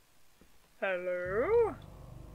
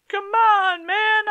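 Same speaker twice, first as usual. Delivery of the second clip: excited shout; 9 semitones higher, 6 dB louder, slower than usual. Real, not acted. Gamer finally gets scissors.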